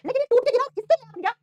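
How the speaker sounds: teacher raising her voice to make it stick; emphatic. Acted. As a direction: drive the point home to the class. Emphatic contrast to pupils.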